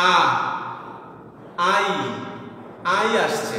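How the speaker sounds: আ then আই sounded out separately; sounding out vowels. Shown as a demonstration. Teacher to students, sounding out vowels. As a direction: break the vowel into আ and আই. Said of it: "Bangla স্বরবর্ণ".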